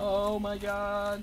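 drawn-out loud oh my god; loud slow anguished cry; loud dramatic wail. Real, not acted. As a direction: wail it slowly and loudly.

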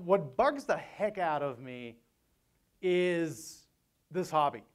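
ordinary talk

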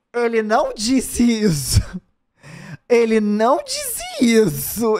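slow, stunned repetition; can't believe what she heard; drawn-out gasp of disbelief; shocked disbelief. Real, not acted. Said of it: "disbelief, repeated slowly".

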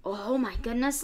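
ordinary delivery

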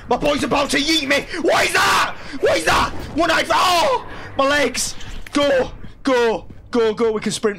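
high, loud frantic outburst; panicked yelling. Real, not acted. Player about to get yeeted, panicked yelling. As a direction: yell in alarm as the monster closes in. Chased by a monster in game.

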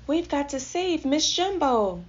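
high, urgent rallying cry; Timothy Mouse. A narrator's reading. Mouse rallying animals to save Miss Jumbo, urgent cry. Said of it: "Timothy Mouse cried".

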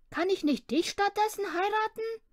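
put-on a small boy proposing in earnest; hopeful little boy. A narrator's reading. Boy hopefully proposes marriage to mother, very high. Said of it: "schlug Lillebror vor".

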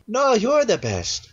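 high, slow cat character. Acted. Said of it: "I'm stuck in the cat".

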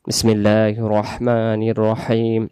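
slow, reverent Arabic recitation. Shown as a demonstration. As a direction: recite it slowly and devoutly. Recites before the lesson. (ধীর, ভক্তিপূর্ণ তিলাওয়াত)

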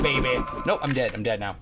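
normal talking voice

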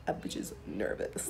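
hushed nervous confession; nervous whisper. Real, not acted. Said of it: "you can hear the shakiness in my voice".

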